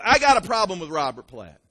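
loud griping phone caller; complaining caller. Retold or imitated. Church member complaining to the pastor on the phone. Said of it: "But, uh".

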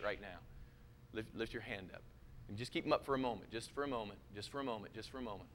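hushed, gentle altar call, fading. Acted. Altar call over music.

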